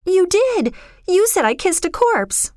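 very high, distressed insistence; upset, squabbling girl; accusing girl. Acted. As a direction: accuse him in a high girl's voice.

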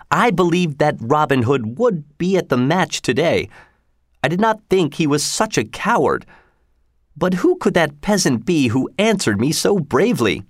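a proud lord sneering at a beggar; pompous sheriff. Acted. Sheriff mocking the ragged stranger, haughty and high.

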